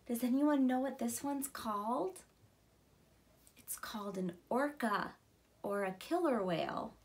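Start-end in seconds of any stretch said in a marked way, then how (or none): none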